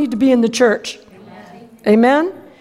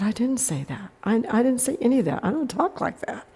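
meek denial; 9 dB quieter than usual. Retold or imitated. Child denying it to the principal.

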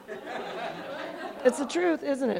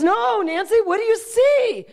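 high-pitched, pressing question; insistent; 9 semitones higher, 3 dB louder than usual. Retold or imitated. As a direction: demand a better answer. God pressing harder for an answer.

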